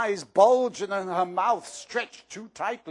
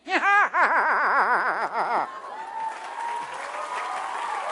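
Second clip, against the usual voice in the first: shrieking cackle; 8 semitones higher than usual. Acted. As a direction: shriek with laughter. Peeves cackling, shouting.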